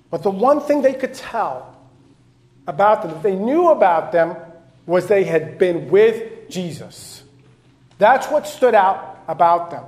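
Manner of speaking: loud storytelling sermon; emphatic pulpit retelling; forceful preaching. Acted.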